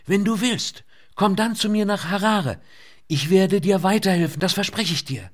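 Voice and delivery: high, loud urgent woman's voice; young woman shouting. A narrator's reading. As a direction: raise voice over the din. Lynette keeps shouting over rotors.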